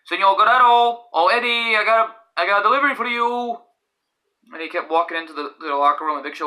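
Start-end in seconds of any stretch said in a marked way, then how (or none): 0.0-3.6 high mock delivery announcement; imitated delivery man. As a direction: sing out a delivery call, pitched up. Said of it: "he was like".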